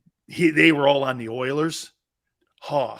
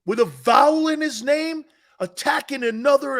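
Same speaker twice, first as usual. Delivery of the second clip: mock outrage; 3 semitones higher, 3 dB louder than usual. Mock scolding over Italian names.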